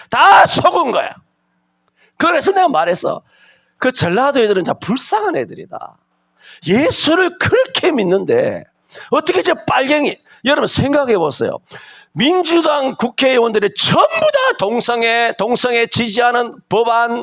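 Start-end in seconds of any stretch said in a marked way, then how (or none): 0.1-1.1 emphatic slow declaration. Real, not acted. Preacher denouncing, emphatic. 12.1-17.2 preaching shout. Real, not acted. Preacher to congregation, shouting against lawmakers.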